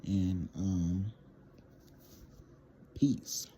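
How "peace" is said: ordinary delivery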